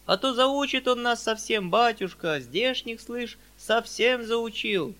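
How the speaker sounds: high, drawn-out grievance; complaining peasant. Acted. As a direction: complain plaintively to the master as a peasant. (жалобный мужицкий голос, нараспев)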